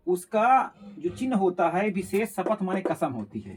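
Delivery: ordinary delivery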